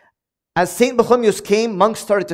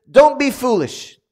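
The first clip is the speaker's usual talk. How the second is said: slow, higher, firm rebuke; blunt rebuke; 6 semitones higher, 4 dB louder, slower than usual. Retold or imitated. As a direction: lay down the word to a foolish brother. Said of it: "He says".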